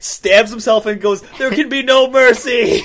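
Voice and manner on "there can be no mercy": high, loud, drawn-out mock cry; hammed-up final proclamation, slow and loud; dramatic cry. A podcaster acts out a character stabbing himself, mock dramatic cry.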